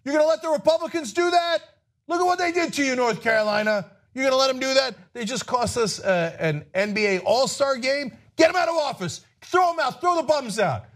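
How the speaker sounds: high-pitched indignant campaign rant; rallying outrage. Acted. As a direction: rile up the voters with climbing, incredulous outrage. Models a rallying speech to voters.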